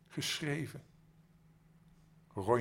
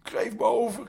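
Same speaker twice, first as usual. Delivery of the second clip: high, loud cry for mercy; shouting; 11 semitones higher, 11 dB louder than usual. Acted. Habuli cries out to the night ghosts, high pleading shout. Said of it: "schreeuwde Habuli".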